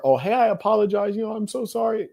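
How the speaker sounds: high-pitched mock apology; imitated apologetic caller. Rude caller phoning back to apologize, mocking high voice. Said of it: "and then it's her".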